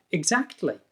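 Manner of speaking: high-pitched, positive agreement. Shown as a demonstration. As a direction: show you really agree. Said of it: "it sounds very positive".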